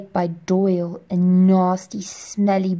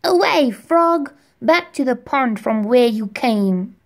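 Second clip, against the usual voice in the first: put-on little boy knight commanding a bullfrog to leave; brave boy knight; 4 semitones higher, 5 dB louder than usual. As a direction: shout like a small knight chasing off a frog. Knight boy shouting at frog.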